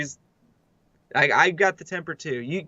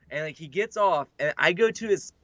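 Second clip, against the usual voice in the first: ordinary talk, nothing marked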